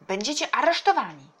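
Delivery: loud, high warning call; shouted threat. A narrator's reading. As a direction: threaten them from across the water. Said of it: "wołał z daleka wuj". (głośna groźba wykrzyczana z daleka)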